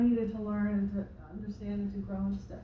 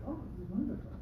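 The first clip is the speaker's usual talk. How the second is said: lightly put-on Indian accent, a bit softer; a mild, self-mocking Indian accent impression; Deepak Chopra accent; 2 semitones lower, 6 dB quieter, faster than usual. Retelling Deepak Chopra on Conan, mild Indian accent. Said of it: "Terrible Deepak accent".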